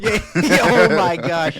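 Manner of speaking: oh my gosh through laughter; laughing. Real, not acted. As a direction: laugh the words out loud. Laughing at recording mishap.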